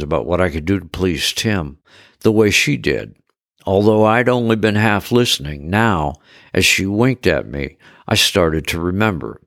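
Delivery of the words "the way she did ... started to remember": ordinary delivery, nothing marked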